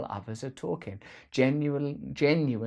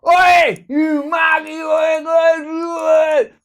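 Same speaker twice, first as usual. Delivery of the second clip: high, loud mocking yell of "Oi"; loud jeering street shout; rude shout; 15 dB louder, much slower than usual. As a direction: bellow at someone across the street. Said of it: "someone goes to me".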